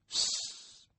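long voiceless hiss; hushing "sss". A narrator's reading. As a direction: shush him with a drawn-out sss. Boy hushes the man. (sibilo per zittire)

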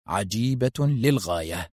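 low, slow, lingering; slow wonder. A narrator's reading. Narrator on Foster's strange new idea, slow wonder.

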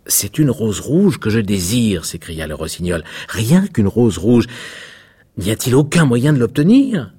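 supplique plus haute et plus forte; rossignol implorant. A narrator's reading. (rossignol désespéré, montant vers l'aigu)

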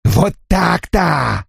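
slow, high gloating cry; triumphant. Acted. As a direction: crow in victory. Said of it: "победно воскликнул джентльмен".

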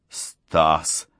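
low, weak croak; hoarse croak. A narrator's reading. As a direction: croak the name out from where you lie. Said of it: "прохрипел лежащий рядом Гельмут".

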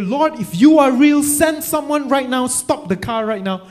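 loud, high-pitched retold plea to God; desperate prayer. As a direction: cry out a desperate prayer, acting it out. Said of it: "he finally made a prayer". (模仿他大声急切地向神祷告)

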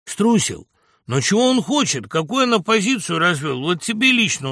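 high, loud challenging questions; heated argument. A narrator's reading. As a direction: press a fellow passenger for an answer. Passenger arguing heatedly on train. (горячий спор, громко)

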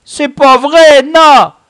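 put-on very high, loud childish denial; stubborn denial. A narrator's reading. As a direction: deny it like a cornered kid. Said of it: "continua-t-il à nier". (nier obstinément en criant)

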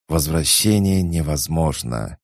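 ordinary delivery, nothing marked

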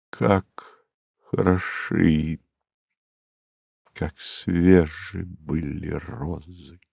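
slow, soft, sinking recitation; fading refrain. A narrator's reading. Narrator's memories of the family fade, slow and hushed. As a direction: let each phrase sink into quiet.

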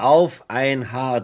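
slow, loud, savoured triumph; triumphant. Acted. Inspector tells Diamond of the match, triumphant. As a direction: draw it out with relish.

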